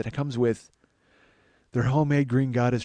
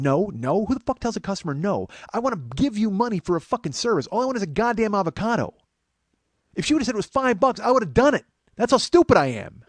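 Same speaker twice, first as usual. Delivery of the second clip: louder and higher, a ranting build that boils over; rising outrage; 8 semitones higher, 5 dB louder than usual. Retold or imitated. Comic retelling the restaurant refusal, building into an angry rant. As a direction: vent the disbelief harder with every line. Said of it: "I started to get progressively madder".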